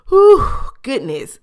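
loud, slow whew of disbelief; exasperated whew. Real, not acted. Podcaster reacting to Trina blinking back tears, loud exhale. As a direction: blow it out, overwhelmed. Amused exclamation.

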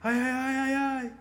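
gritos agudos de dor imitados. Retold or imitated. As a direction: ais bem agudos e altos, imitando o homem ferido. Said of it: "com esse homem gritando lá atrás". (homem ferido gritando de dor na carroceria, gemidos agudos)

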